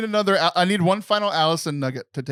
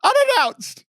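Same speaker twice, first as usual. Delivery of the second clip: outraged squeal; 3 dB louder, slower than usual. Acted. Mock outrage at Jesus.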